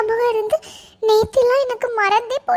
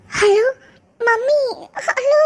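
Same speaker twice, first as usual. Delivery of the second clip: crying into the phone; 4 semitones higher, much slower than usual. Acted. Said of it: "angel ஏன் அழுறா?".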